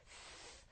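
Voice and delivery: 스 as a voiceless s hiss; unvoiced ending. Shown as a demonstration. Teacher showing the unvoiced plural ending, 스 hissed without voice. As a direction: let 스 be only air, no vowel. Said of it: "같이 안 울립니다".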